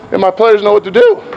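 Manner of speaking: usual speaking voice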